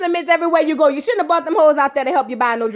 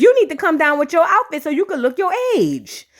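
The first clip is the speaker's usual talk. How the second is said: ordinary talk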